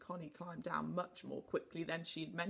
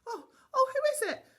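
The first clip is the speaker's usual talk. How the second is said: much higher, louder startled cry; frightened cry; 11 dB louder than usual. A narrator's reading. Startled Connie cries out on the ladder, high and loud. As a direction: shriek it in fright. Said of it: "cried Connie".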